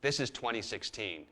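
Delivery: ordinary talk, nothing marked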